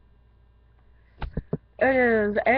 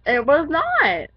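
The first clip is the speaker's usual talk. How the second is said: insistent, drawn out; loud slow denial; 6 semitones higher, 8 dB louder, much slower than usual. Real, not acted. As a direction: stretch out "not", loudly. Arguing about the time.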